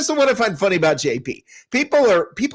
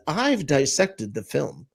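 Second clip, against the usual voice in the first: low, soft, slow self-styled expert; mock condescension; 6 semitones lower, 6 dB quieter, slower than usual. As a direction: calmly talk down, imitating a know-it-all. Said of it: "condescendingly with this".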